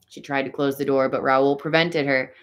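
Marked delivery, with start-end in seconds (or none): none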